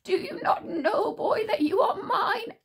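high, eerie creature voice; King Witch gloating over Tsar; menacing witch. A narrator's reading. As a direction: claim the boy as yours.

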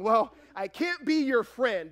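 loud, high, drawn-out protest; exaggerated mock objection; mock complaint. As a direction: mimic someone overreacting, slow and loud. Mimics a wrong takeaway.

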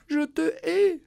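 mock shout, pitched high; retold shout. Daughter recalling what she shouted at her father, high mock shout. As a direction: fling it back as a remembered cry. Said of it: "je lui ai crié".

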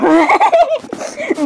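very high, squeaky laugh; squealing laugh. Acted. Laughing after calling Monjiro.

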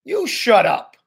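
high-pitched angry retort; yelling back. A narrator's reading. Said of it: "Julian yelled back".

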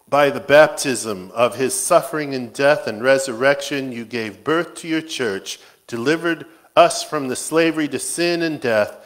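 ordinary talk, nothing marked

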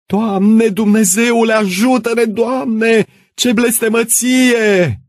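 very high, loud wail to God; woman crying out. Acted. Woman at the roadside begging God, high cry. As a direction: shriek a desperate prayer. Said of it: "o femeie ridicând brațele striga".

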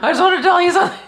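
laughing. Real, not acted. Laughing while speaking.

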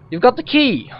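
high, loud burst of excitement; excited exclamation. Acted. Prisoner reacting to news of the key, excited.

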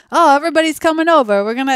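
put-on high, fast, excited Jersey Italian-family accent. An Italian family inviting everyone over, in an excited Jersey accent. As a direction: play up a loud New Jersey accent. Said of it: "my Jersey accent isn't very good".

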